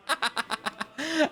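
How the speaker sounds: high, delighted laugh; high-pitched cackle of amusement. Real, not acted. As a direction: burst out laughing at the joke. Laughs at own piracy joke.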